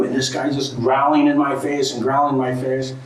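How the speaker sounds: usual speaking voice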